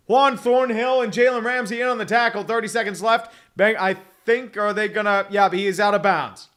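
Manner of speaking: energetic football commentary; live play-by-play. Acted. Streamer calling a Bengals drive, live play-by-play. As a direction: call the play as it unfolds.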